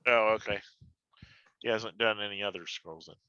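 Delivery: usual speaking voice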